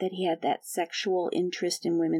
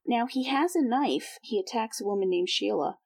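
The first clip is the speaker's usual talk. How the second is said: ordinary delivery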